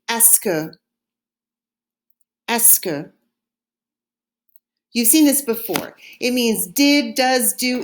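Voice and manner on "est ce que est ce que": est-ce que as two quick syllables, ess-kuh; French est-ce que. Shown as a demonstration. Teacher models est-ce que for the class to echo. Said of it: "Say it after me".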